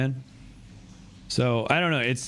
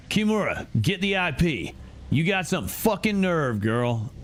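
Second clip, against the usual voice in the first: higher, threatening snarl; angry gangster; 6 semitones higher than usual. Acted. Gangster orders Kimura and threatens the intruder, angry. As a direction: threaten the hacker girl.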